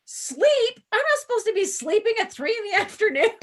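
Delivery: high, fast startled disbelief; shocked surprise. Retold or imitated. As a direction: squeal it out like you just woke up confused. Imitated confused person waking up.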